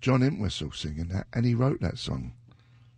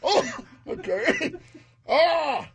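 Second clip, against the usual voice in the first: laughing; slower than usual. Real, not acted. Laughing reaction.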